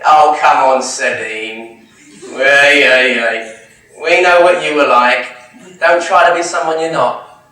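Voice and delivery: hammed-up "who are you kidding" heckle; mock dismissive teasing. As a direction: scoff loudly, imitating disbelieving family. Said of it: "when you go back home".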